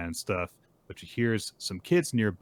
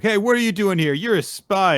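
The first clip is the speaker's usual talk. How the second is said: put-on loud, high-pitched kids' accusation; acted playground challenge, raised and loud; accusing shout; 13 semitones higher, 10 dB louder than usual. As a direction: yell it like a kid confronting a stranger. Kids accuse the old man.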